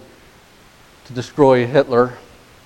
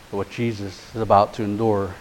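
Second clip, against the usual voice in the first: ordinary talk, nothing marked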